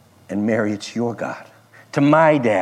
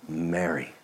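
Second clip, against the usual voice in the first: much softer and lower; tender; 7 semitones lower, 9 dB quieter than usual. Acted. Jesus gently speaking Mary's name, soft and tender. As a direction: say it gently and inviting. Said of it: "the tone when it's kind and gentle".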